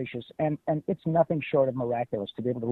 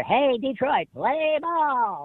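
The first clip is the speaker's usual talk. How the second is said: high, cheery cartoon mouse call; Pinky voice; 11 semitones higher, much slower than usual. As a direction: announce it like a squeaky cartoon mouse. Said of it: "asked Pinky ... to say".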